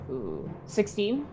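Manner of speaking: ordinary talk, nothing marked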